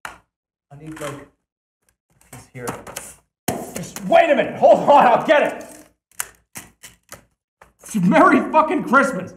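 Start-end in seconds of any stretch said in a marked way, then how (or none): 3.8-9.3 loud, high, insistent outburst; raised, agitated voice; worked-up shouting. Real, not acted. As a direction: holler it at an impatient audience. Struggling, then getting train together.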